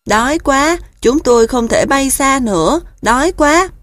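high, loud pleading goose chicks; hungry goslings. A narrator's reading. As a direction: beg like starving little geese. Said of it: "giọng đáng thương". (giọng ngỗng con kêu đói)

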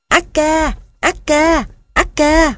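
very high pitiful gosling calls; heartbreaking cry. A narrator's reading. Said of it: "nghe đứt ruột". (tiếng kêu đứt ruột của ngỗng con)

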